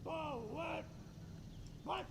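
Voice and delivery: slow, high, drawn-out parade call; drill command. Real, not acted. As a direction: sing out the order across the field. Military drill commands at ceremony.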